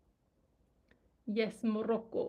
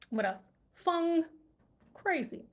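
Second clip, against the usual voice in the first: normal talking voice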